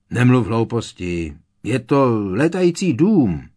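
put-on know-it-all boy correcting a girl. A narrator's reading. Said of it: "opravil ji Dennis Creevy".

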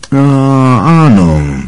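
a slow, drawn-out agreeing yes. A narrator's reading. Teacher Lupin slowly agreeing with Harry, drawn out. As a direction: stretch the words as if thinking. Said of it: "přitakal protáhle Lupin".

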